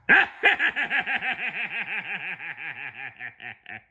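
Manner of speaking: long high villain cackle; evil laugh. Acted. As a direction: cackle like a tiny cartoon villain. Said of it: "He laughed a long, loud, evil laugh".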